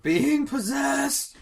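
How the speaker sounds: hammed-up possessed outburst; mock possessed. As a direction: draw it out, leaping high on the end. Jokingly breaks into possessed voice.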